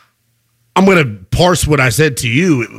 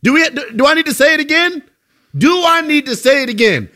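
high, incredulous repeat; a host pushing his co-host; 8 semitones higher than usual. Real, not acted. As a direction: press the point in disbelief. S1 emphatic disbelief.

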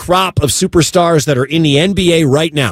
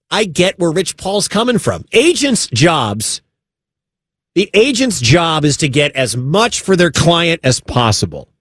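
usual speaking voice

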